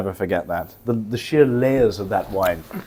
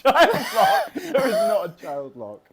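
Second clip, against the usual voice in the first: laughing disbelief; 11 semitones higher, 5 dB quieter, slower than usual. Real, not acted.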